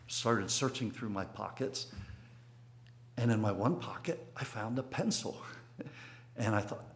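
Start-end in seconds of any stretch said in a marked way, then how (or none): none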